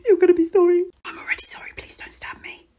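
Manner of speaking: voiceless threatening whisper. Acted. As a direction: hiss the threat without voice.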